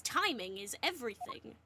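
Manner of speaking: ordinary talk, nothing marked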